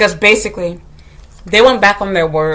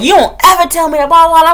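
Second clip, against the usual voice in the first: raised, high-pitched mock telling-off; retold yelling; 8 semitones higher, 4 dB louder than usual. Teacher down on his level scolding boy, acted yell. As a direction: scold hard, as if yelling at a child. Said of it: "I yelled at him".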